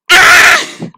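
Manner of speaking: high, loud comic scream; short high-pitched yell; frustrated scream. Acted. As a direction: cry out in mock despair. Screams at the romance turn.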